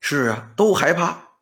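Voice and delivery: plain reading voice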